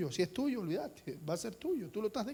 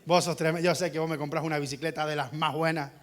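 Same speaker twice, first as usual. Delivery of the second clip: lower, wheedling praise of dad; butter up the father; flattering son; 7 semitones lower than usual. Retold or imitated. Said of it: "viene mi hijo y me dice".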